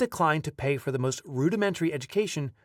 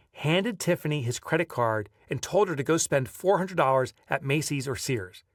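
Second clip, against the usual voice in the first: ordinary talk, nothing marked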